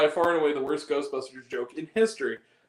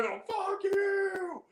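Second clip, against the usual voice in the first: very high, drawn-out squawk; silly falsetto cursing; mock high-pitched shout; 13 semitones higher, much slower than usual. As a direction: screech each repeat like a cartoon voice. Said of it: "But you just hear the way".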